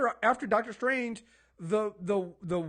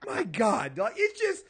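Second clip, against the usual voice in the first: laughing in disbelief; 9 semitones higher than usual. Real, not acted. Laughing exasperation at the statement.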